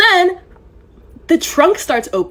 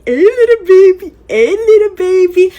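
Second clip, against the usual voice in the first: put-on higher, louder coo to a baby; baby talk; 6 semitones higher, 4 dB louder than usual. Woman dodging her date, higher baby-talk coo to the infant. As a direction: coo at the baby to dodge the table. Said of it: "I'm talking to the little baby".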